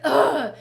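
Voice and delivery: loud mock groan of annoyance; annoyed ugh. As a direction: groan it like a sulky friend. Friend's annoyed reply.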